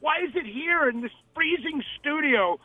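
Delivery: high-pitched indignant questioning; retold outrage. Artist quoting a studio visitor, outraged and high-pitched. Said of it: "this one woman was outraged".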